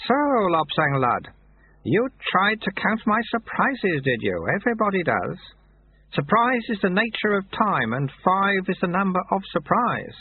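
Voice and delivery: lightly teasing wisdom; sage old sweeper. A narrator's reading. Sweeper teases the novice about counting surprises, knowing.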